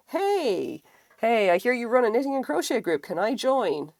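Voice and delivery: eager self-quote. Retold or imitated. Asking the knitting group leader to join, eager. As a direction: bounce in like a keen newcomer. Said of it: "going like".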